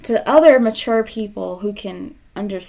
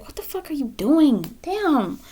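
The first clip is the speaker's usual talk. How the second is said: higher, louder exasperated scolding; exasperated spirit guides; 6 semitones higher, 3 dB louder than usual. Retold or imitated. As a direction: vent as the fed-up guides. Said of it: "your guides ... they're like".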